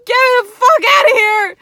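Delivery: high, loud panicked yell; frantic shriek to escape. Retold or imitated. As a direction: scream to get out, terrified. Retold panic at ghost.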